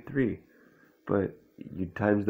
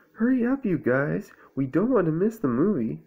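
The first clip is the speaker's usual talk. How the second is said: high, raised voice hurrying friends; urgent calling; 8 semitones higher, 3 dB louder than usual. A narrator's reading. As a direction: call out urgently as Owl. Owl hurries friends from bathroom.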